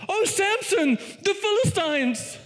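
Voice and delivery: very high, drawn-out mock cry; mock shout. Delilah warns Samson of the Philistines, mock high shout. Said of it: "And Delilah shouts".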